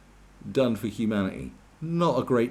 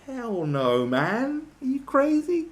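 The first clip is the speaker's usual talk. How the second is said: climbing far higher into disbelief; rising outrage, are you mad; incredulous; 14 semitones higher, 4 dB louder, slower than usual. Real, not acted. Incredulous at robot-overrun England.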